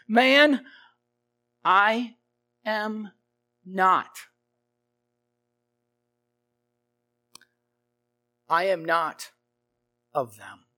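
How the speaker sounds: each word set apart by long pauses; halting denial. Acted. Peter denying to the servant girl, word by word. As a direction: deny it word by word, slowly.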